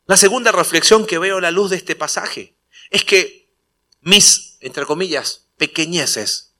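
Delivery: loud, rising preacher's delivery; preaching at full force. Acted. Pastor driving home his point to the congregation, preaching loudly.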